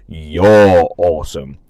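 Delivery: mock-deep "You're awesome"; deep slow "you're awesome". Shown as a demonstration. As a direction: drop low and stretch every word. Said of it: "Maybe that".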